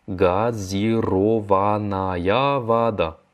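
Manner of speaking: Газированная вода stretched out, every syllable separate; very slow repeat. Shown as a demonstration. Teacher models the phrase for students, very slowly. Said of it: "ça se dit".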